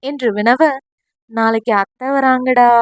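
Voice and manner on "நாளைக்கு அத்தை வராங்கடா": ordinary delivery, nothing marked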